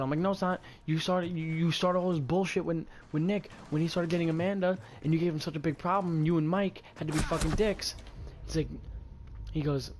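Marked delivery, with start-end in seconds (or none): 0.2-7.9 raised, higher accusing voice; heated accusation, short of a real scream; retold screaming. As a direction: argue back indignantly, pitched up. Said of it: "I was screaming at him".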